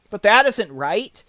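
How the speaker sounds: a lively straw man protesting; bright-voiced scarecrow objecting.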